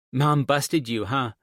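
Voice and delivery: put-on high, loud kid voice; teasing daughter. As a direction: tease Dad like a knowing child. Daughter teases her dad.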